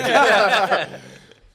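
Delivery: high, loud burst of laughing; loud laughter. Real, not acted. Picturing dad passing him on the mat, loud laugh. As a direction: crack up loudly.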